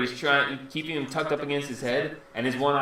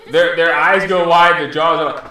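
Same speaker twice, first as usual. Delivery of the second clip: ordinary talk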